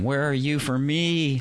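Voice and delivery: high, slow whiny plea; pleading lovelorn guy. Retold or imitated. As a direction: beg like a lonely guy. Imagined guys pleading.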